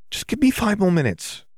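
high-pitched pleading character voice; high, bargaining schoolgirl; pleading girl. A narrator's reading. Hermione pleading with Fleur in story.

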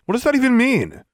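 high, loud exasperated question; indignant demand. A narrator's reading. Vexed student baffled by correction, demanding. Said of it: "Pete demanded".

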